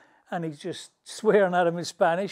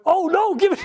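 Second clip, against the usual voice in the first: much higher and louder panic; panicked; 11 dB louder than usual. Retold or imitated. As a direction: grab the phone in a panic. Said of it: "he went".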